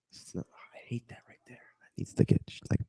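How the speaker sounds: whispered aside, away from the mic; breathy muttered complaint to herself; whispering about messy hair. Real, not acted. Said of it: "fixing hair, whispering".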